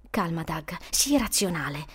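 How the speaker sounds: low, soft inner voice; steady yourself under your breath; calming self-talk. Acted. Boy reasoning with himself, low calming self-talk. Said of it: "si disse".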